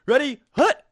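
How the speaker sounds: high, loud call of the snap; mock quarterback yelling hut. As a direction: bark out the count at the line. Acted quarterback calling the snap.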